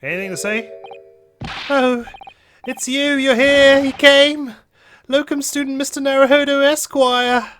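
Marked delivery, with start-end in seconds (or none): 1.7-7.5 much higher, louder, slow gushing; overjoyed prisoner. Acted. Natsume greets his visitors in prison, with high loud gushing. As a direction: gush with high, loud delight.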